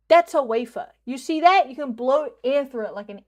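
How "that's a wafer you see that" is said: emphatic. Real, not acted. Lecturing chat loudly.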